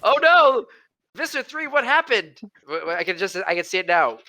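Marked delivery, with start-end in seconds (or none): 0.0-2.4 high, mock-dismayed voice; alarmed underling. Acting out the disc's loss to Visser Three, mock alarm. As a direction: play a mock panic, pitched well up.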